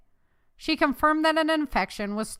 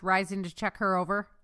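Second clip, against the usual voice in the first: ordinary delivery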